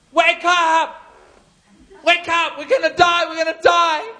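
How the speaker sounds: loud, high frantic yell; panicked yelling. Retold or imitated. Disciples yelling at sleeping Jesus, frantic panic. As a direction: shout him awake in terror. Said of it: "they're like".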